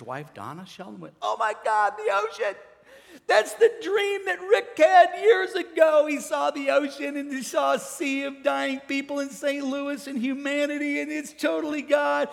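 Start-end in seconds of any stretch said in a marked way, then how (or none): 1.2-12.3 high, impassioned retold outcry; overwhelmed outcry. Pastor's wife hears the ocean dream matches, crying out. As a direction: cry it out high, loud at the peak.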